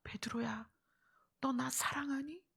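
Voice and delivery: soft, hushed question; Jesus. Retold or imitated. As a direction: drop the volume and ask tenderly. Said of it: "다시 묻습니다. 예수님이".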